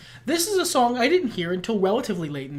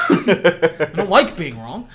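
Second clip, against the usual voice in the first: laughing; 2 semitones lower, slower than usual. Real, not acted. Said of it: "laughing admission".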